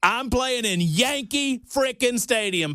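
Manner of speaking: high, drawn-out bravado; comic boasting. Real, not acted. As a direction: stretch it out high like a grand boast. Comic boast about missed career.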